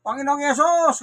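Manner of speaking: a hammed-up shout outside the house; loud calling out. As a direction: call out to the Lord like shouting from the yard. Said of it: "sisigaw kang malakas".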